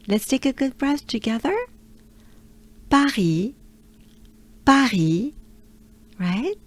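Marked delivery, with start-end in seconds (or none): 2.9-5.3 Paris said the French way, throaty R, stress on the end; pa-RI with a uvular R, no final s. Shown as a demonstration. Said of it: "That's how we say it in French".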